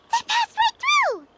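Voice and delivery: high, loud burst of amazement; startled exclamation. Acted. Companion startled that they passed right through, high and alarmed. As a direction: blurt it out in shock.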